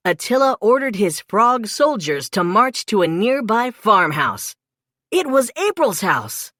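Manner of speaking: ordinary delivery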